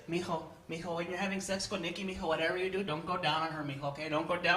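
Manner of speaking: low, soft granny voice; low, hushed grandmother warning her grandson. Retold or imitated. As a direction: lean in like a scolding old nana. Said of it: "My nana's sex advice is like this".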